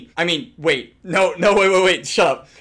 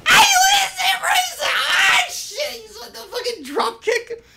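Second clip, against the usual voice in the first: much higher, laughing screams of delight; laughing excited shriek. Real, not acted. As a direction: squeal with delight at the skin. Hype over the new skin.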